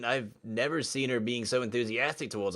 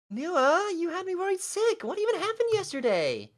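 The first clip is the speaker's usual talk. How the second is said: much higher, fretting schoolgirl; high girl voice; 4 dB louder than usual. Acted. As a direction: play the worried girl. Voicing Tiffany worried.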